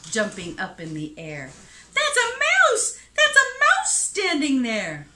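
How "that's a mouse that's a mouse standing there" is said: high, loud storybook panic; frightened elephant shouting. A narrator's reading. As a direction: cry out in acted terror at a mouse. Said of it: "shouted the elephant".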